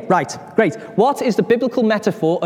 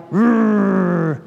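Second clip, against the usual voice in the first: long rolled growl; mock giant growl; 3 semitones higher, 4 dB louder than usual. Goliath facing the Israelite army, mock growl.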